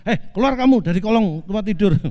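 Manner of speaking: mock stern command. Wife orders husband out from under the bed, mock stern command. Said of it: "saya tegas".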